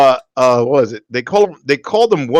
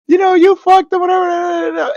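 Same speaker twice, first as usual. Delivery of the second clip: mock crying friend. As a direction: whine it out like the upset friend. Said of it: "starts crying and thinking".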